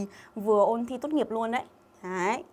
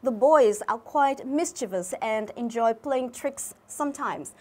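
teacher reading an example sentence to the class, slow and clear; slow, careful reading; much slower than usual. Shown as a demonstration. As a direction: read the English sentence slowly and clearly, word by word. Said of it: "teacher reads the example sentence slowly".

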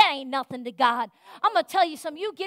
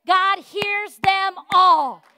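slow, loud, higher on "all"; slow emphatic preaching; 5 semitones higher, 10 dB louder, much slower than usual. Real, not acted. Preacher assuring the congregation, slow loud emphasis. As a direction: drag out "God hears them all" then shout it.

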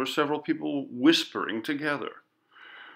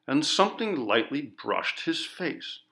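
usual speaking voice

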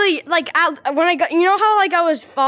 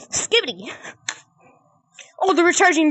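usual speaking voice

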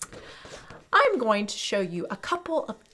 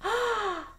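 mock gasp; 9 semitones higher than usual. Delighted discovery for kids.

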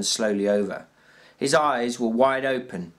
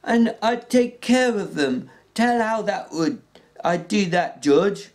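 Lenny's voice, high and pleading; 9 semitones higher, 4 dB louder than usual. Acted. Lenny begging George to tell again, high and pleading. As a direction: beg like a big simple child.

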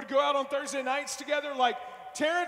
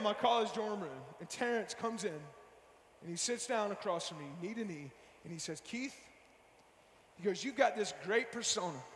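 hushed retelling; 7 semitones lower, 7 dB quieter than usual. Retold or imitated. Preacher to youth group, quietly retelling a friend's challenge. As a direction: draw the room in close.

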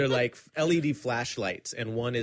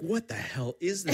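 mock startled; 6 semitones higher than usual. Startled by lightsaber flashlight.

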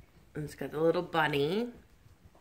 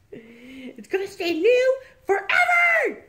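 high, loud and slow triumphant joke; gleeful shout; 14 semitones higher, 11 dB louder, slower than usual. Real, not acted. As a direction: crow it out delighted. Joke about reborn doll.

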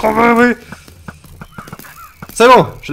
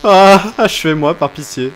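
mock despair; 4 semitones higher, 8 dB louder, slower than usual. Miserable mining.

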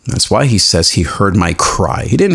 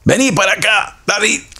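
put-on much higher mom's voice calling her boy in Spanish; 13 semitones higher, faster than usual. Pastor imitating his mom calling him home, high-pitched. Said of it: "we know exactly what our moms sound like".

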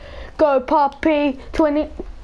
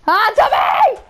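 shouted higher and louder at the enemy; angry shout; 7 semitones higher, 4 dB louder than usual. Real, not acted. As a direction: yell it at the player who killed you. Yelling at opponent in game.

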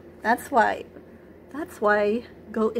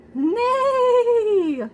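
high, loud whinnying neigh; whinny it like a horse; 13 semitones higher, 5 dB louder than usual. A narrator's reading. Horse answers Duck with a loud high neigh. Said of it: "said Horse".

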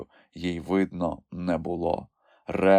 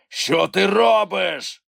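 high, louder, slow creature call; puzzled gruff shout; Grampus calling out; 11 semitones higher, 5 dB louder, much slower than usual. A narrator's reading. Grampus asks Kulka what she is doing, puzzled creature call. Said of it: "гукнув Грампус".

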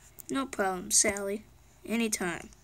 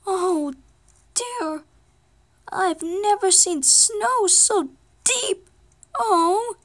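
much higher and louder, slow wailing dismay; alarmed cries; 7 semitones higher, 6 dB louder, slower than usual. Pretend. Toy car alarmed at deep snow.

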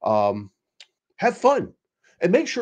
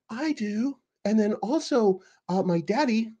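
high, softer mocking witness voice; mocking impression of a witness on the stand; 9 semitones higher, 3 dB quieter than usual. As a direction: play him naive and dim. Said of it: "I'm just making voices up as I go".

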